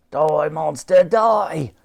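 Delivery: loud villain yell; high shouted battle cry. A narrator's reading. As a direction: bellow it like a crazed gunner. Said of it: "yelled Buckshot".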